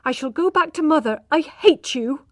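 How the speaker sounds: high, heated declaration; high, angry outburst. A narrator's reading. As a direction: fling it at him in anger.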